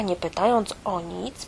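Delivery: usual speaking voice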